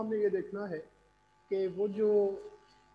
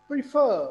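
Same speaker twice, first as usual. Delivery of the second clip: Prefer with a loud, high फर; prefer as pre-FERR, falling at the end; stress on FER; 6 semitones higher, 10 dB louder, slower than usual. Shown as a demonstration. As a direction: punch the second syllable of prefer. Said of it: "किसको stress किया".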